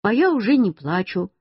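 ordinary delivery, nothing marked

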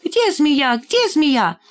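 high-pitched excited squeals; squealing children. A narrator's reading. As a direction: squeal it like startled first-graders. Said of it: "подняли писк и визг". (детский писк и визг)

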